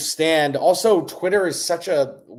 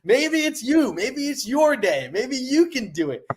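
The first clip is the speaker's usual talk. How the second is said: much higher, swooping pep talk; rousing encouragement; 9 semitones higher than usual. Real, not acted. Trader urging listeners to believe in themselves, rousing. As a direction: lift each hopeful line high, like rallying someone.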